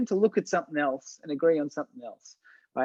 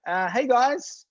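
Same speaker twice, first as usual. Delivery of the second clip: tentative intervening; 3 semitones higher than usual. Retold or imitated. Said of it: "silly enough to go".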